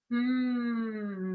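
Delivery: steady closed-lip hum, gliding down; long hum. Shown as a demonstration. Coach circling the hips, long hum. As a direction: hum through one long breath. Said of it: "put a hum in that".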